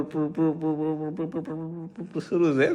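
puffed, soft buzzing purr, repeated and rising; imitating a bee buzz. Teasing wife about her bee-like buzz, mock humming. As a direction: buzz like a bumblebee, lightly mocking. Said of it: "ભમરો કેમ આમ પુર".

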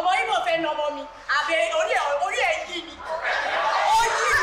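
loud scolding in Yoruba; scolding mother-in-law. Acted. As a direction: rattle off the complaint loudly.

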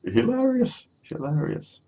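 very high, squeaky voice after laughing; high-pitched, spent-from-laughing amusement; helplessly amused. Real, not acted.

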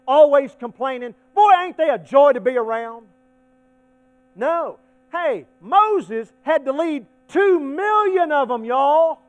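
high, loud pulpit exclaiming; full-force preaching. Acted. Preacher rails against whiners and bellyaching, full-force preaching. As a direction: belt it out to the whole church.

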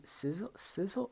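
puzzled sounding out. Shown as a demonstration. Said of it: "How do you say this word?".

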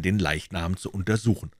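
ordinary delivery, nothing marked

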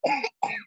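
hammed-up choking and coughing; acted choking cough. Tyler chokes on his laughter, acted cough. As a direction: pretend to choke. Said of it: "He choked".

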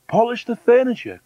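high, loud bossy order; shrieky bossy wife; woman's cry. Acted. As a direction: cry out the chore sharply high. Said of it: "cried the woman".

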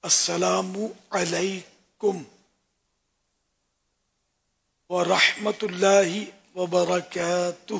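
السلام علیکم ورحمۃ اللہ وبرکاتہ said very slowly, every syllable clear; correct pronunciation. Shown as a demonstration. Scholar shows viewers the right way to give salam, slow and careful. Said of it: "تو صحیح تلفظ ہیں اس کے".

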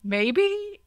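"maybe?" climbing high into an unsure question. Real, not acted. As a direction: end "maybe?" on a big high rise. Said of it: "Question mark?".

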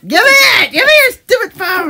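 loud, high, insistent demand; demanding shout. Real, not acted. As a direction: yell it as you grab. Grabbing phone in a tussle.

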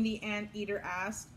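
usual speaking voice

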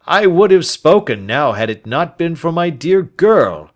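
put-on loud, earnest old man's plea; loud, insistent pleading old man; pleading old Turner. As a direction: swear it with urgency.

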